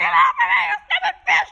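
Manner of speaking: high, fast squealing shout; panicked toy shriek. Pretend. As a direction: squeal it as the toy being bitten. Toy attacked by fish.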